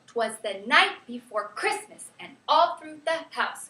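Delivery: slow recital hammering "night", "Christmas", "house"; slow da-da-DUM beat landing hard on "night" and "house"; exaggerated verse rhythm. Shown as a demonstration. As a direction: stretch it out, punching every third syllable: "night", "Christmas", "all", "house". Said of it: "Listen again".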